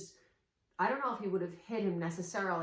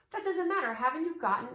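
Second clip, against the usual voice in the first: much higher and louder, cutting the objection off; emphatic rebuttal; 5 semitones higher, 4 dB louder than usual. Real, not acted. Coach to parents brushing off an objection, emphatic and loud. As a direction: shut the excuse down hard.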